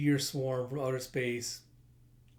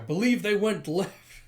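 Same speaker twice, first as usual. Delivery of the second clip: raised, higher henchman voice; louder repeat; 6 semitones higher, 6 dB louder than usual. Acted. Henchman repeating directions to his lord, raised voice. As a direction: call it out to be heard.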